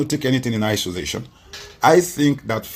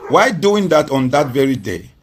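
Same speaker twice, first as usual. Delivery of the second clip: usual speaking voice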